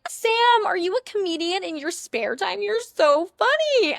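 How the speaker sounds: high, loud gushing fangirl, drawn out slowly; mock the girl who laughs too hard at his jokes; shrill over-the-top flattery, slow on the last words; overeager girl. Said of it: "You don't have to be like".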